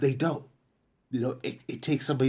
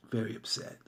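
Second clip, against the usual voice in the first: softer echo of "Very upset", dropped low; quiet repeat; 6 semitones lower, 11 dB quieter than usual. Shown as a demonstration. Repeating the client's mood to viewers, hushed. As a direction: let "Very upset" fall away under the breath. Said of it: "she was very upset".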